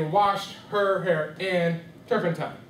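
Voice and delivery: Washed her hair in turpentine, slow separate words then turpentine squeezed together; off rhythm. Shown as a demonstration. Teacher trying a rhythm that is not quite right, off rhythm. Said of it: "Does that sound right?".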